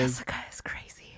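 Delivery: hushed gossip whisper. Real, not acted. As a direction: whisper it behind her back. Gossiping about Jessica.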